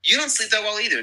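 delighted surprise at a shared habit; high, amazed question; surprised. Real, not acted. Learns friend also can't sleep.

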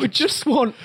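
high British parody; mock British accent. Host continues the mock appeal, high British accent.

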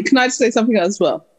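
usual speaking voice

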